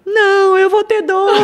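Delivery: high, loud, hammed-up cry breaking into laughter; comic tearful outburst; mock wail. Retelling her tearful reply to stranger.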